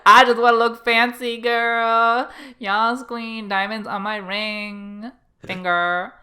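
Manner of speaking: loud, high hammed-up sass; sassy diva. Cohost voicing klepto Anne showing off, sassy diva. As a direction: flaunt it like a mock diva, drawn out at the end. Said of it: "She's like".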